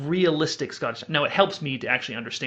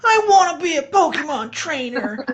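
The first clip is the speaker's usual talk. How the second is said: very high, slow, loud cartoon kid; exaggerated squeaky cartoon-kid voice; adult faking kid's voice; 6 dB louder than usual. Shown as a demonstration. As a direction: overdo a little boy with tons of vocal fry. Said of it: "go really high and then add, like, tons of vocal fry".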